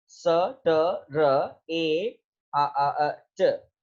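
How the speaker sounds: ट र ए drawn out very slowly; sound by sound. Shown as a demonstration. Teacher segments the word for students, very slow. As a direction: sound each letter separately, very slow. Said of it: "एक ही मर्तबा ये सारे एक ही sound के साथ बोले".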